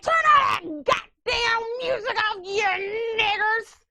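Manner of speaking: high-pitched angry shout, last words stretched out; Clayton Bigsby. Retold or imitated. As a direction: yell it like an irate man over blaring music. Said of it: "Clayton Bigsby. ... and he's like".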